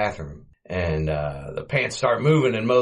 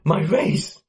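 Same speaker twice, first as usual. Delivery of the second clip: higher, louder dismayed cry; mock dismay; 7 semitones higher, 4 dB louder, slower than usual. Morton seeing his destroyed room, louder dismayed cry. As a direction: wail over the wrecked room.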